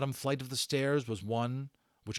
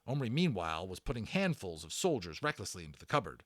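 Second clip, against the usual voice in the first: usual speaking voice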